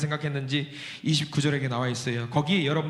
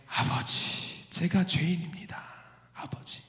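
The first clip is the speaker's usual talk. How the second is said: humble confession; 7 dB quieter, slower than usual. Acted. Younger son confessing.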